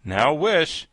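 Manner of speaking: lightly voiced concern; anxious. A narrator's reading. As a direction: urge it with a touch of worry. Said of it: "said the Tin Woodman anxiously".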